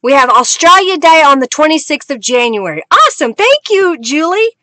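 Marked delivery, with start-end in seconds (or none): none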